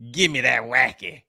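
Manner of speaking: lightly attempted New Orleans accent, low. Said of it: "with that Nolans accent".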